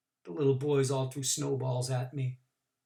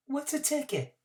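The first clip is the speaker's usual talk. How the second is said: put-on high, soft, puzzled child; high child's voice, innocently puzzled; innocent boy; 11 semitones higher, 4 dB quieter than usual. A narrator's reading. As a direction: ask like a bewildered little boy.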